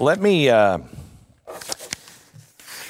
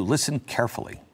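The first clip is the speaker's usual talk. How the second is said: ordinary talk, nothing marked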